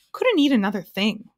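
loud, overfull puffing duke. A narrator's reading. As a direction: puff it out as if too full to eat. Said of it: "puffed the Duke".

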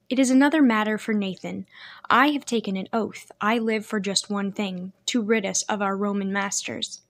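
ordinary delivery, nothing marked

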